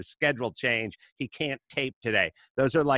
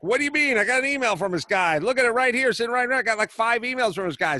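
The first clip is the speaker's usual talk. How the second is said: high, loud, offended protest; he's insulted and lets you know it; indignant outburst; 9 semitones higher, 4 dB louder, faster than usual. Retold or imitated. Co-host protesting the cancelled email, outraged. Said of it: "he was like".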